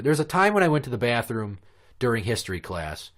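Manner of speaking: ordinary delivery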